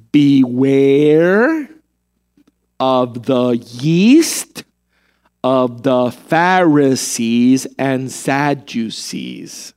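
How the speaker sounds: drawn-out, low, word by word; very slow. Acted. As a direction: spell it out slowly like a patient teacher. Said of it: "say it to you again slowly".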